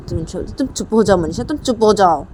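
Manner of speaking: acted annoyance, rising in pitch; angry outburst. Nisha silencing Manisha and blaming Papa, angry. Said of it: "अपने पापा के ऊपर गुस्सा करने लगती".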